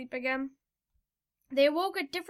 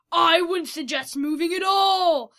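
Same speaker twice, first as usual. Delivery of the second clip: loud, raised villain shout; menacing yell; 5 semitones higher, 9 dB louder than usual. A narrator's reading. Fido crashing through the shack, threatening yell. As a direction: bellow it as a threat. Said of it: "yelled Fido".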